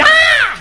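loud, high re-enacted scream of fright; retold scream. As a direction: scream as if spotting a snake. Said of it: "And so I screamed".

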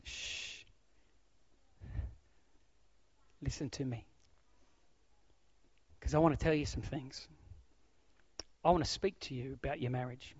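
whispered shush, then soft low words; God gently calling you to listen; God speaking softly. Pretend. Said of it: "for God to say to us".

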